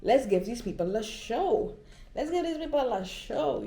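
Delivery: rally yourself to perform; hyped. Retold or imitated. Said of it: "I'm like".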